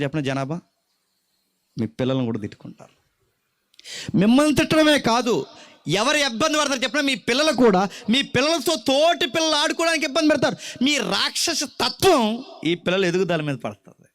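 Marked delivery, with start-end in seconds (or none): 4.2-14.1 put-on preacher pressing the congregation on a lonely child; full-force preaching. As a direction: preach it out at the top of the voice.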